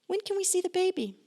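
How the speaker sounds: high, bright mock visitor voice; squeaky visitor asking to see baby; mock eager visitor. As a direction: chirp it like a pushy relative.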